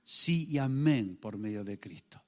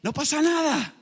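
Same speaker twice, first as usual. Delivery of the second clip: higher, louder and fast, a cheerful no-problem cry; relieved exclamation; 9 semitones higher, 8 dB louder, faster than usual. Pretend. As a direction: burst out relieved, like it is nothing to fear. (exclamación alegre y aliviada, más aguda y fuerte)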